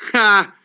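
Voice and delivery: single sharp "ha" of triumph; short, mocking laugh; taunting laugh. Real, not acted. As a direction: bark out one gloating laugh.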